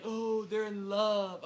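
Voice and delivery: drawn-out, gushing "oh, they're in love"; slow sentimental mock coo; mock swooning. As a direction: gush it slowly and loudly, mocking the sentiment. Mocks sympathy for the lovers.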